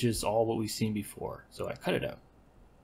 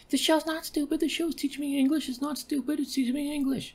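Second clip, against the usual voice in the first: far higher and louder squeal; shrill indignant wife; 5 dB louder than usual. Acted. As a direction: defend the show in a high squeal. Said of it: "She's like".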